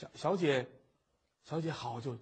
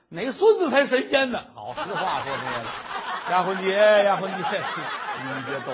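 loud pleading through laughter; the teased scholar pleading loudly while laughing; laughing plea; 8 dB louder than usual. Acted. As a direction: beg her between laughs.

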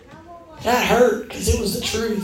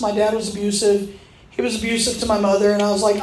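ordinary talk, nothing marked

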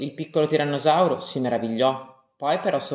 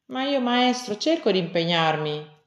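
put-on higher, small childlike voice pleading; a little one trying to justify himself; little dinosaur excusing himself; 6 semitones higher than usual. Little Rex excuses himself to the teacher, small high voice. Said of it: "si scusò piccolo Rex".

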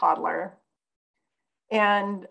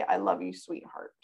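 tender; 7 dB quieter than usual. Retold or imitated. Mother's planned gentle reply to toddler.

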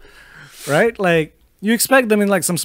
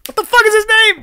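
high-pitched frustrated cry; exasperated outburst; 3 dB louder than usual. Real, not acted. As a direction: burst out, straining for the name. Cannot recall an actor's name.

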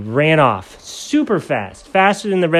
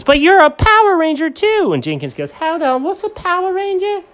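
squeaky voices. Acted.

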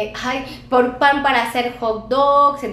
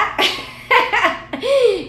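high burst of laughter; 10 semitones higher than usual. Real, not acted. Laughs at Yolanda's reply.

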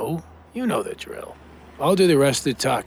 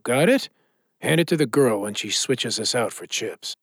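ordinary delivery

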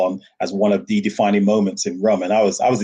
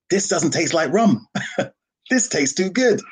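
higher, amazed quote; delighted surprise; 8 semitones higher than usual. Retold or imitated. Taster surprised by the rum, higher delighted exclamation. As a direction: marvel at the rum. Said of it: "one of my favorite phrases".

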